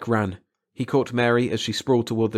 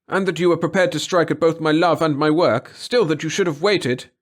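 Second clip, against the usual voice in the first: baffled reasoning; 7 semitones higher, 6 dB louder than usual. A narrator's reading. Brother reasoning with sisters.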